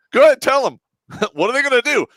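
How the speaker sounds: loud, cocky mock shout; defiant retort. Telling the lieutenant to go ahead, loud defiant. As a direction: dare him to do it.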